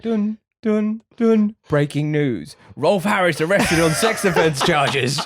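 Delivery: mock news jingle hummed high, then a slow announcer read; BBC breaking news jingle. Hearing it on the work kitchen radio, slow mock newsreader. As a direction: ham up a BBC news bulletin, sting and all. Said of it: "the breaking news bulletin from the B B C".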